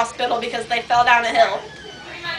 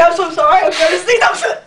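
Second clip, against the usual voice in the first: high, louder put-on plea; mock pre-sneeze apology; 4 semitones higher, 4 dB louder than usual. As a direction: imitate someone fighting back a sneeze. Friend about to sneeze, apologising.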